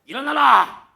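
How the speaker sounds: high, loud commanding yell; wake-up shout. Shown as a demonstration. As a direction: blast them out of bed. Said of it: "제 목소리면 딱 좋죠". (청년들을 깨우는 크고 높은 호령)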